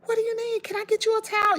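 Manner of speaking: high, fluttery mock-panic woman voice; panicky neighbor. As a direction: squeak it like she's flustered.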